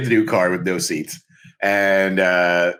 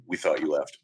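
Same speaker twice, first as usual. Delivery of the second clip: much lower and softer, meek; cowed mumble; 8 semitones lower, 8 dB quieter than usual. Retold or imitated. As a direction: shrink back like scared thugs. Dock workers cowed.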